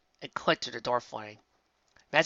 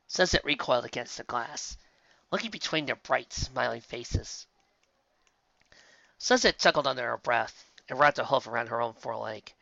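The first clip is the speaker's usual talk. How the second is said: usual speaking voice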